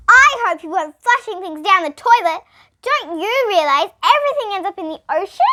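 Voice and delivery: loud high scolding sister. Acted. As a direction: tell him off sharply.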